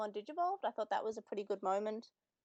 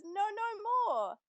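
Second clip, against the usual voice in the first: higher, slow pleading voice; mock beaten villain; 10 semitones higher, 3 dB louder than usual. Host voicing the beaten Ogamon begging, higher and slow. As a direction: whimper it like a defeated thug. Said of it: "Ogamon says".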